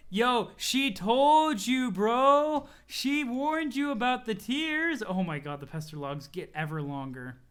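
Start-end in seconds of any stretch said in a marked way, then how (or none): 0.1-5.0 drawn-out, loud and higher sing-song; milk every word to rub it in; slow gloating taunt. Acted. Friend gloats at Dave over tears, slow and loud.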